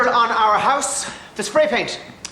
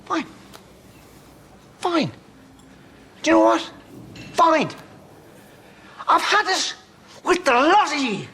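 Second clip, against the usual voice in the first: exasperated outburst; 6 semitones higher than usual. Acted. He snaps at the whole group.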